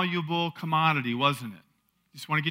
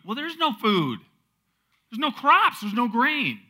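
high, loud, incredulous objection; exasperated protest; 8 semitones higher, 5 dB louder than usual. Acted. Preacher objects to asking for food in drought, exasperated. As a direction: protest it with pitch jumping up.

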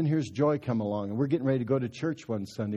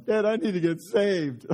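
put-on higher little-boy plea, half laughing; crying little boy; 8 semitones higher, 4 dB louder than usual. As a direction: mimic a tearful kid asking to be saved. Son Mike crying to his dad.

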